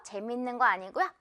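ordinary talk

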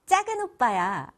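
된소리 세게 "작은 오빠야"; tensed consonants. Shown as a demonstration. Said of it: "이렇게 세게 된소리를 하는 경우가 많아요".

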